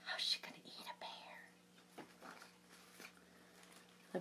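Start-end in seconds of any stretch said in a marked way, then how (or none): 0.0-2.0 whispered aside; stage whisper. Real, not acted. Storyteller whispering to the children. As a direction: lean in and whisper. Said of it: "aside to listeners".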